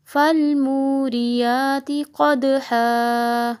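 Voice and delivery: slow, high, loud melodic tilawat; Quran recitation. Acted. Teacher reciting the full surah for students to practise. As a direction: chant the whole surah with long held notes.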